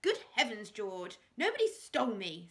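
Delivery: loud, sharp snap; wife snapping at her husband. A narrator's reading. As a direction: snap at him in irritation. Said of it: "snapped Lady Hastings".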